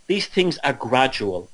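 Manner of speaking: usual speaking voice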